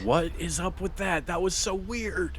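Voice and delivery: high-pitched, incredulous exclamation; baffled disbelief. Real, not acted. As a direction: protest a bizarre glitch, voice way up. Baffled by game lag glitch.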